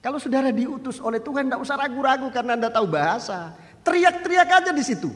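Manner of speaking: fiery sermon exhortation; preaching. Acted. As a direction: urge the flock on, climbing to a shout. Pulpit exhortation.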